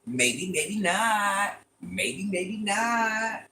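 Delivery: slow, noncommittal drawl, held long on "not"; drawn-out teasing. Real, not acted. Streamer dodging chat's guess about her accent, drawn-out teasing. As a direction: stretch each word right out, very slowly.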